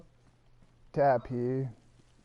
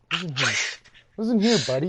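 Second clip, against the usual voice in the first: loud, higher mock Boston tough-guy bark; hammed-up Boston "listen here, buddy"; 5 semitones higher, 7 dB louder, faster than usual. Tough-guy Boston accent bit.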